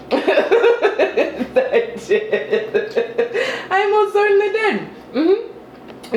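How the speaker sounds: high-pitched, laughing confession; laughing admission. Real, not acted. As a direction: laugh through the words, voice climbing high. Laughing at her own confession.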